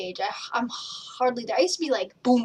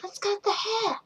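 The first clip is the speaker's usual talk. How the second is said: much higher put-on voice for Joey; Joey's voice; 10 semitones higher, 4 dB quieter than usual. Imitating friend Joey at the hilltop, higher campy voice. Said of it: "you think I'm making that voice up".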